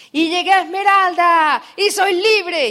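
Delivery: loud, high and slow, arms wide; a speaker on stage proclaiming she made it, triumphant; triumphant shout. Retold or imitated. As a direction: shout your freedom to the crowd. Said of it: "empieza a gritar y a abrir los brazos".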